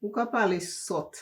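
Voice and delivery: mock dismissal in Patois. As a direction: imitate a mother scolding in Patois. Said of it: "She said it in Patois".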